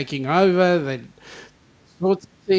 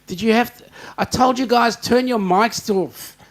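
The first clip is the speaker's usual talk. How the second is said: higher and louder, slowing on turn your mics off; scolding call; 6 semitones higher, 4 dB louder than usual. Real, not acted. Host scolding the guys downstairs to mute their mics, loud. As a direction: tell them off across the house.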